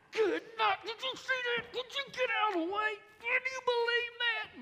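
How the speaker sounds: high, loud exasperated rant; ranting driver. Retold or imitated. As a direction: fume at the traffic in disbelief. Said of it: "by yelling at 'em".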